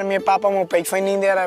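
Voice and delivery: usual speaking voice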